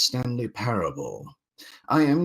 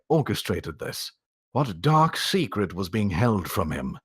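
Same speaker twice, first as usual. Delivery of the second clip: louder, fuller storytelling voice; story narration; 3 semitones higher, 4 dB louder than usual. Acted. Reader narrating the Stanley Parable to a voice chat, in a storytelling voice. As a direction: draw listeners into the tale.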